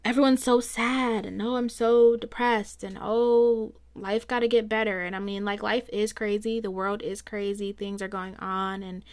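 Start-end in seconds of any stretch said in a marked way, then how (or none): none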